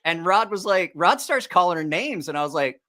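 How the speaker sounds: ordinary delivery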